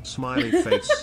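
laughing. Real, not acted. Laughing mid-chant.

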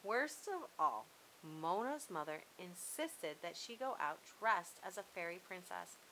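ordinary delivery

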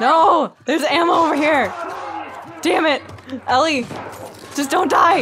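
loud, high panicked pleading; frantic shouting. Real, not acted. Gamer to Ellie in a fight, frantic shouting. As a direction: shout urgently to a companion in danger. Urging companions during the fight.

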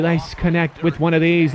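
normal talking voice

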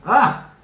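sudden high, loud "ah!"; short burst of overflowing affection; affectionate squeal. Real, not acted. Reader gushing over how much she loves viewers, affectionate squeal.

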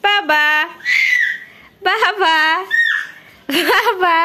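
high, loud repeated call. Real, not acted. Calling child repeatedly.

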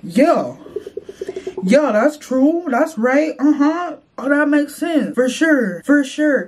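high, eager yes-man chatter; excited agreement. Retold or imitated. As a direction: hype yourself up, nodding along. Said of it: "I just be like".

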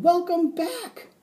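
very high, loud hamster cheer; squeaky shout. A narrator's reading. Hamster greeting his returning teacher, squeaky shout. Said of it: "climbed up to the top of my cage and shouted".

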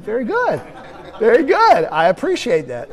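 big amused praise of a cheeky reply. Retold or imitated. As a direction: laugh it off with mock approval. Praising candidate's cheeky answer.

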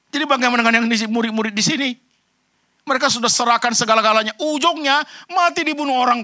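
high, loud fervent preaching; preaching at full force. Acted. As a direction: lift the whole room with conviction. Impassioned climax about martyrs. (khotbah berapi-api, tinggi dan lantang)

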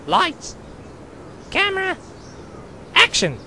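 director's call. Acted. Director starting the take.

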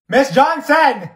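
loud, drawn-out call of "Miss Johnson!"; calling out loudly. Acted. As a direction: shout her name so she finally hears. Calling teacher, unheard.

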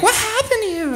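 put-on high-pitched child's voice; curious kid. Kid asking a stranger what happened, high and childlike. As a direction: ask it in a squeaky little kid voice. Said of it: "So they'll be like".

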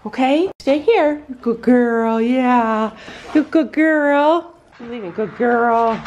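high, slow praise for the horse; pet talk. Real, not acted.

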